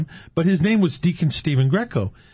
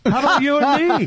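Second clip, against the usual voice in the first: high-pitched, amused echo; delighted echo; 13 semitones higher than usual. Real, not acted. Guest echoing the host about how they met, delighted. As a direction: throw it back higher, with delight.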